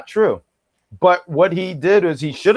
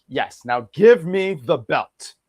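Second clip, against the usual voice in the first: slow, drawn-out mock demand; slow smug imitation of Melo; 3 semitones lower, much slower than usual. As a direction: stretch it like holding out your hands. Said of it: "Melo put his hands out like".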